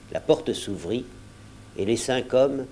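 ordinary delivery, nothing marked

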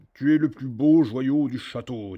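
loud booming giant voice; ogre flattering. A narrator's reading. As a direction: croon praise to the harp. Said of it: "dit l'ogre à la harpe".

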